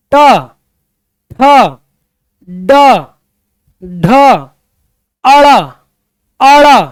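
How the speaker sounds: each retroflex sound said apart and loud, tongue curled back; loud, deliberate demo of ट ठ ड ढ. Shown as a demonstration. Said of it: "जीभ हल्का सा मुड़ेगा".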